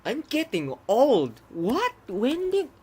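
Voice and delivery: high, loud startled questions; can't believe what you see; shocked disbelief. Real, not acted. Still disbelieving the change.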